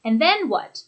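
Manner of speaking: "then" high, "what" falling away; "And then what" peaking, then dropping at the end; eager prompt with a downward finish on "And then what"; falling intonation. Shown as a demonstration. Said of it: "with that falling intonation".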